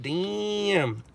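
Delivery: ordinary talk, nothing marked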